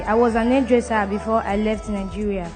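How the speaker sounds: usual speaking voice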